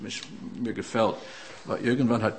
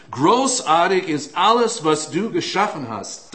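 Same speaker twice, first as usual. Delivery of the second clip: raised, ringing pulpit voice, pressing forward; fervent preaching; 5 semitones higher, 7 dB louder than usual. Acted. Preacher urging the congregation toward change, in a raised preaching voice. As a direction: preach it out to the whole room. Psalm proclaimed.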